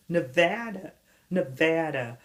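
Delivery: Nevada like banana, stressed middle VA; "nuh-VA-duh", weak first and last vowels; American pronunciation. Shown as a demonstration. Teaching the state's name, Nevada rhymed with banana. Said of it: "la même prononciation que banana".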